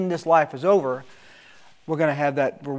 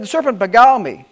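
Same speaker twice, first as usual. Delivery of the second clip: Eve blaming; 6 semitones higher, 8 dB louder than usual. Acted. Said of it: "The woman said".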